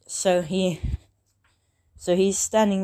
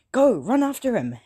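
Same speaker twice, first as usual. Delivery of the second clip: a mock yell pitched high and louder; retold shout; 7 semitones higher, 4 dB louder than usual. President Mike orders the contestants to run, mock yell. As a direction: bark the order to chase him.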